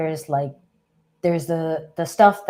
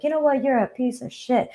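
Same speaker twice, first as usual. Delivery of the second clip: mock yell; 7 semitones higher than usual. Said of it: "yelling at you and being like".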